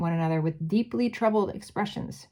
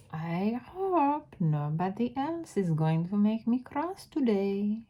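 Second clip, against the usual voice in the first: put-on slow, coolly threatening witch accent; Grand High Witch accent; 3 semitones higher than usual. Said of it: "do the accent".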